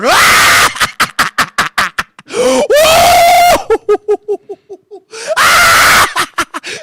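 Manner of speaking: loud, high maniacal laughter; Joker cackle. Retold or imitated. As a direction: cackle wildly in long, rising bursts. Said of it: "I'm cracking away like the Joker".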